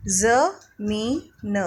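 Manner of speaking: ज़मीन said very slowly with a z sound; slow teaching demo of ज़मीन with z; slow sounded-out word. Shown as a demonstration. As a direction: draw out ज़मीन, buzzing the ज़. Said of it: "जैसे मैंने अभी आपको पढ़ के बताया".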